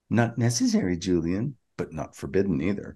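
soft, low self-talk, tapering off; Bashir talking to himself. Retold or imitated. Co-host quotes a character's tag line, musing softly to himself. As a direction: murmur it privately as if to yourself. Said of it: "the way he talks to himself".

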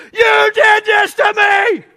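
high, loud, slow scream; screaming wife. Retold or imitated. Wife in labor screaming at husband, loud and high. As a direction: scream the blame at him. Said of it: "she was just screaming at me".

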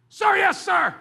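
loud, high, clear recruit shout; shouted sir yes sir. Shown as a demonstration. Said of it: "Clear and distinct".

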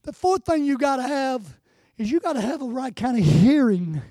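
normal talking voice

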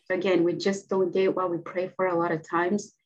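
usual speaking voice

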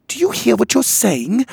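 high, loud indignant cry; outraged. Acted. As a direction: protest in shock, voice up high. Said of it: "and then composing herself added".

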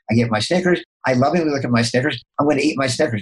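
ordinary talk, nothing marked

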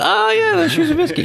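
high squeaky recognition voice; sudden high-pitched oh-yeah; mock recognition. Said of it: "and they go".

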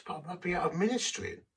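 a man afraid of losing his ministry, protesting to God; anxious plea. Retold or imitated. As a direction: protest with rising worry. Pleading with God in despair.

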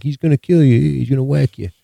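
lightly voiced goon; crime-boss henchman. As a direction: play the boss's sidekick issuing threats. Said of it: "the other guy's like".

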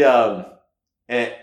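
normal talking voice

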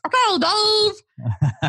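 high, slow squeaky chipmunk. Retold or imitated. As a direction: stretch it out like a chipmunk. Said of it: "you think of the [name]".

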